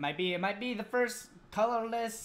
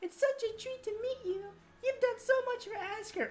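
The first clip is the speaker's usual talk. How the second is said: high falsetto queen. Acted. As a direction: speak as a gracious queen. Voicing queen in cutscene.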